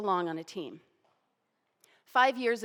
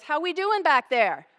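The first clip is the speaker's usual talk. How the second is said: louder, higher call across the room; 7 semitones higher, 12 dB louder than usual. Retold or imitated. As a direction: call to the back row. Coach calls to the back of room.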